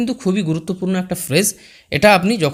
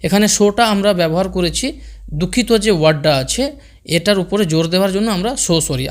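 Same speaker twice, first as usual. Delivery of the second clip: ordinary talk, nothing marked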